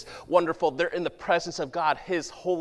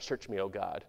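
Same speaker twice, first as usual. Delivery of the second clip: much lower and softer, a quiet plea; hushed prayer; 8 semitones lower, 10 dB quieter than usual. Acted. Pastor praying the psalm to God, hushed and soft. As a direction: pray it inward, barely above a murmur.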